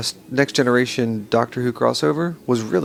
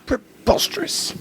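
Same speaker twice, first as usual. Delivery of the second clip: mock outraged; slower than usual.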